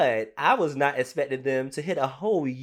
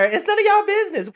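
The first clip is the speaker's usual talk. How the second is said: much higher and louder, fast; loud sass; 8 dB louder, faster than usual. Real, not acted. Cohost defending the housewife, loud and high. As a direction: snap it out to the haters.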